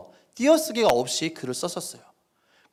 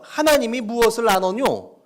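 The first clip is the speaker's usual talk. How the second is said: North Korean dialect; 4 semitones higher, 5 dB louder than usual. Shown as a demonstration. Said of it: "북한 사투리를 쓴다고 생각하고 읽으시면".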